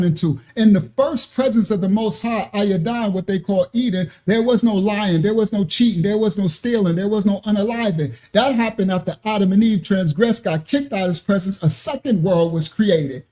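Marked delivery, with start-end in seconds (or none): none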